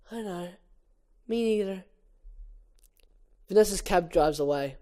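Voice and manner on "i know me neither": soft sad reply. Acted.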